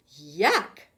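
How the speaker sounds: loud, low disgust; disgusted yuck. A narrator's reading. Camilla pretends to hate lima beans, loudly disgusted. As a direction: spit the word out in distaste. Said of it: "she said".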